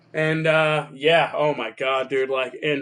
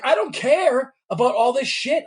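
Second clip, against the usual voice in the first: fed up; 8 semitones higher than usual. Retold or imitated. Losing cool with phone rep.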